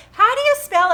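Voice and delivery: mocking the kids' endless question; mock whiny kid question. Mom mimicking her kids asking how to spell, high and loud.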